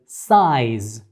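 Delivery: "size" said slowly, the diphthong stretched from "a" to "i"; slow example word. Shown as a demonstration. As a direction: slowly model "size" for the class. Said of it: "If you read this in Spanish".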